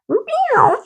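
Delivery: high whispered cat cry; thin, high, breathy meow imitation. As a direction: mimic a hurt cat's meow. Imitating injured cat cries.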